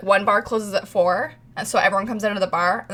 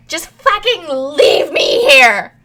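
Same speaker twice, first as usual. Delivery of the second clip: slow, louder mock wail; exasperated outburst; 6 semitones higher, 7 dB louder, much slower than usual. Friend on the platform tells group to leave her, mock wail. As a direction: drag it out in frustrated despair.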